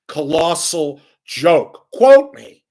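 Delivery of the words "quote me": high, loud challenge; defiant shout. Real, not acted. Radio host daring listeners to quote him, shouting. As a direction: dare them to quote you.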